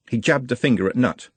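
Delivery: ordinary delivery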